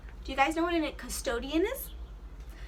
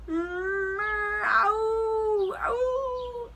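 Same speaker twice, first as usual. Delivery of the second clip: high plaintive little meow; a lightly voiced kitten crying from a tree; stuck kitten mewing for help; 8 semitones higher, 4 dB louder than usual. The stuck cat meows.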